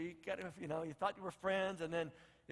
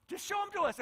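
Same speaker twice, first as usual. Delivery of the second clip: pitched high, begging Philip; eager pleading; 11 semitones higher than usual. Retold or imitated. Philip begging Jesus, high eager plea.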